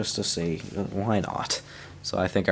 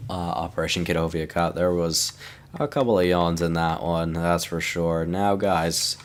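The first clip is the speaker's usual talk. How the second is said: usual speaking voice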